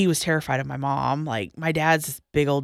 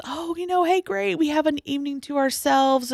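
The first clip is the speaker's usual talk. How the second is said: much higher, sing-song mock of carefree cheer; mocking cheerful husband; 10 semitones higher than usual. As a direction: mimic him acting breezy and pleased. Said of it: "he was acting like this".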